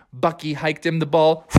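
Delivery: ordinary delivery, nothing marked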